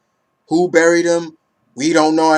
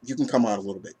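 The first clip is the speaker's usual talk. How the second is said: hushed aside; 4 semitones lower, 9 dB quieter, much faster than usual. Real, not acted. Calling softly to someone offscreen.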